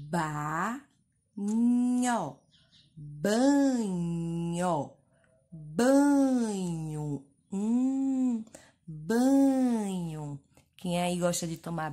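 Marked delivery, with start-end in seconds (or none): 0.1-10.4 Ba, nho, then Banho loud and long; blending Banho. Shown as a demonstration. Teacher blending Banho with children. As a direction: join the syllables into Banho. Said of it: "Nós vamos ler as letrinhas juntas".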